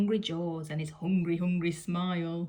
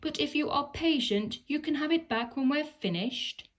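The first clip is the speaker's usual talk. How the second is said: much higher, cheeky monkey voice; teasing monkeys; 8 semitones higher than usual. Acted. Monkeys teasing the piglet about patience in high cheeky voices. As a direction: dangle the promise mischievously. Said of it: "cried the monkeys".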